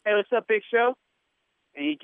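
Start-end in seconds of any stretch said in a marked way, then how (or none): 0.1-1.0 higher-pitched casual greeting, lifting at the end; upbeat hail. Retold or imitated. Greeting a giant wrestler while pretending to belong, upbeat hail. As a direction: call out brightly as if you belong.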